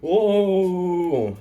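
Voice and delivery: high, loud shocked cry; startled shout. Retold or imitated. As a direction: yell it in surprise. Demon bursts out.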